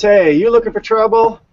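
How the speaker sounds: threatening neighbor. Retold or imitated. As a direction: neighbor losing patience, challenging the kid. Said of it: "He goes".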